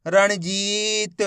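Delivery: रणजीत very slow, with जी held long; teacher drawing out रणजीत; blended slow reading. Shown as a demonstration. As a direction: join रणजीत slowly, stretching the ee. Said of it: "मिलके बन जाएगा".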